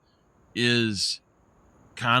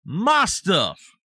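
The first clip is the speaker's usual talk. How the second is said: "my" punched high and loud; stress on my; 7 semitones higher, 5 dB louder than usual. Real, not acted. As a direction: hit the word "my", louder and higher.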